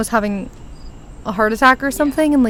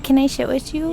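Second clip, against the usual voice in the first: small, softer timid voice; mock shy; 2 semitones higher, 5 dB quieter than usual. Recalling asking to join friend at lunch, mock timid. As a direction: imitate your shy self, mushing the words. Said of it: "like, scared".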